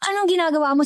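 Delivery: angry vice president catching the cook at the sickbed; sharp angry demand. A narrator's reading. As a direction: catch her in the act and demand answers. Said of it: "isang matalim na boses ang umalingaw-ngaw".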